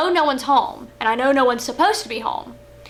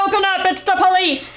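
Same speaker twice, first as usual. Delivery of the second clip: a sister imitating her brother's fake police shout; brother playing cop; 7 semitones higher than usual. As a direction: pound out the cop line like a prank. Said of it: "I said".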